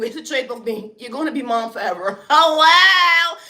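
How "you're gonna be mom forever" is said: ordinary talk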